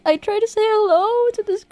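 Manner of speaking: high, laughing voice as the guilty mime; sheepish mime. Retold or imitated. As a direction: play the embarrassed mime, giggling. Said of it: "And they're like".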